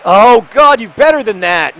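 sudden high, loud scolding; loud cry of real frustration; exasperated outburst. Real, not acted. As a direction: burst out yelling at your player. Interrupts answer to scold player.

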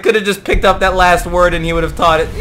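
words through real laughter; laughing. Real, not acted. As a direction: laugh at your own blunder while speaking. Laughing at own mistake.

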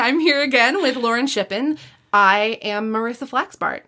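ordinary delivery

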